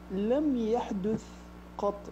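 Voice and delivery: slow, deliberate formal fusha; formal Standard Arabic. Shown as a demonstration. To the confused Gulf caller, slow formal fusha. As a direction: spell it out in stiff classical Arabic, very slow. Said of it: "أتكلم معاك باللغة العربية الفصحى".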